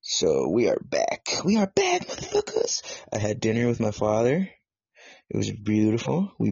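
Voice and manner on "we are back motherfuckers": much higher, rising shout of a return; hype announcement. Real, not acted. Host hyping up his listeners, excited higher shout. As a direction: blast it out like a hype man.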